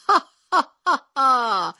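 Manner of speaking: high, loud jungle call; Tarzan yell. Acted. Tarzan calling out over the desert, jungle yell. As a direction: cry out like Tarzan across the desert.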